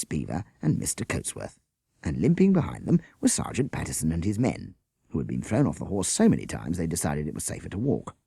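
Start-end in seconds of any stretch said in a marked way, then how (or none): none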